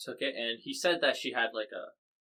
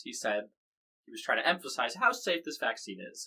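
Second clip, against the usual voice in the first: ordinary talk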